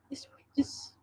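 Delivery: hushed off-mic whisper, almost no voice. Real, not acted. Hushing her son off-mic in a whisper. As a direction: breathe it out so the mic won't catch it.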